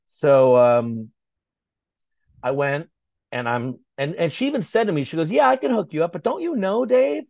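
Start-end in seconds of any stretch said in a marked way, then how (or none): 5.3-7.2 raised, lightly voiced friend asking; a hint of the friend from the label, voice up high; quoting Renee. Publicist friend asks about Dave, lightly voiced higher.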